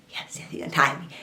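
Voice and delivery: softly whispered yes, yes; whispered relief. Retold or imitated. Captive whispering relief as her captor unties her. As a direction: whisper your relief to yourself.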